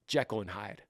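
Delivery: ordinary delivery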